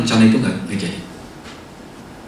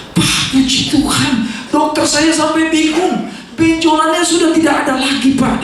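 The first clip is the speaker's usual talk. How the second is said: much higher, slow, joyful woman's voice; joyful healed woman; 12 semitones higher, slower than usual. Retold or imitated. Preacher voicing the healed woman telling him the news, high and joyful. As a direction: lift the voice high with grateful joy.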